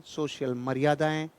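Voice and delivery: ordinary talk, nothing marked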